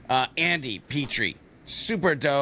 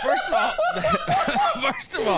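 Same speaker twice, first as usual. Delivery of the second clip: very high, breaking up laughing; words squeezed out between laughs; helpless laughter; 3 dB louder than usual. Real, not acted. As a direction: try to answer through real laughter. Answering while cracking up at the story.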